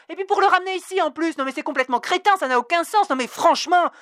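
loud, high, indignant tirade; furious outburst. Acted. Dana yelling at the clerk at the counter, furious. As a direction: tear into the clerk with outrage. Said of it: "Dana avait hurlé dans le hall endormi".

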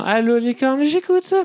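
singsong operator greeting climbing high. Acted. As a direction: sing out the hotline greeting, ending high.

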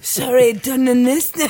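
loud, high put-on English accent. Guest answering the host's English jargon, hammed-up English accent. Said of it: "C'est un accent anglais".

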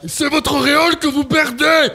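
despairing scream. Acted. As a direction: wail as the king loses his halo. (hurlement désespéré très aigu)

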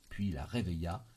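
plain reading voice

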